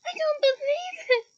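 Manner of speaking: high, loud distressed wail; high tearful wail of dismay. A narrator's reading. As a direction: wail it out, near tears. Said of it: "Hermione wailed".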